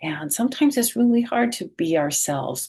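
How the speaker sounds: plain reading voice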